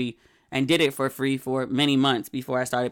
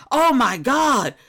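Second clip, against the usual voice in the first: gushing friends; 8 semitones higher, 4 dB louder, slower than usual. Retold or imitated. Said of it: "everybody at the table was like".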